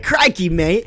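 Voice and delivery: a hammed-up Australian "Crikey, mate" with a laugh; mock Australian accent. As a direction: toss off a jokey Aussie greeting. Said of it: "They're like".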